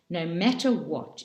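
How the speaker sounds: plain reading voice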